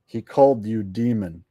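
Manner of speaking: usual speaking voice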